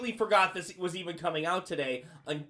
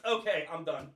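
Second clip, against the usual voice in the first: usual speaking voice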